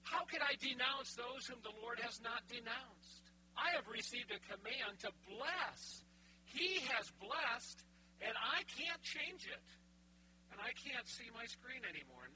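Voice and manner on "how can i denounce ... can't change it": slow, lifted oracle recitation; solemn slow reading of the oracle; scripture reading. Acted. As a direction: declaim it like prophecy.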